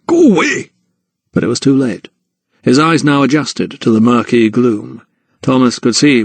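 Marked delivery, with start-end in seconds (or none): 0.1-0.7 high, sharp "leave me" cry; snapped order. A narrator's reading. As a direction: snap it high to drive him off. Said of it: "he snapped".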